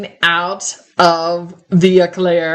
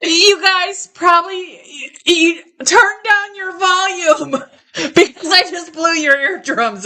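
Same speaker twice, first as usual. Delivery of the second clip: high-pitched giggly apology; laughing through the words; 7 semitones higher than usual. Real, not acted. Laughing after a loud sneeze.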